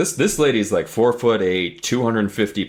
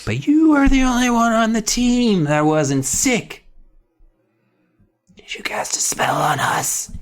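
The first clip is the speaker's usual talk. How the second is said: put-on sick accusing kid; 8 semitones higher than usual. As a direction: play a suspicious sick girl, then whisper the accusation. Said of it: "Judith is just like".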